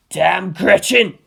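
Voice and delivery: loud slow shouted curse. A narrator's reading. Toji shouts at the kids in frustration, loud curse. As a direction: bellow it in frustration. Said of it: "he shouted".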